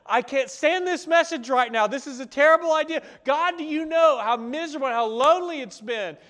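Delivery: high, loud pleading whine; desperate complaint to God. Retold or imitated. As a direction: beg God to take it back. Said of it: "you're saying to God".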